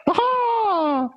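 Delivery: very high, fast squeaky cartoon mouse laughing; a hammed-up Mickey Mouse cackling about the moon. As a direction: chirp it like a giddy cartoon mouse. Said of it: "Mickey Mouse going".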